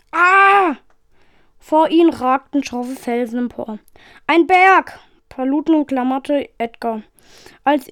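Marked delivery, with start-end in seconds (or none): 0.1-0.8 short, loud, high "ah" of alarm; startled cry. A narrator's reading. Passenger sees rocks towering ahead, startled cry. Shuttle suddenly faces a mountain. 4.3-5.0 higher, louder cry of warning; alarmed shout. A narrator's reading. Passenger warns of a mountain ahead, alarmed shout.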